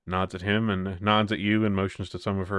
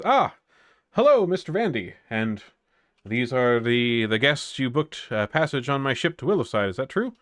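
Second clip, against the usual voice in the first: higher, brighter half-elf captain voice, a bit louder; captain welcoming passengers aboard her ship, higher and louder; 5 semitones higher, 4 dB louder than usual. Acted. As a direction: greet the guests like a gracious sea captain.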